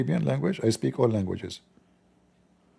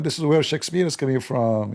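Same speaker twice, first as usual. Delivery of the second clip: normal talking voice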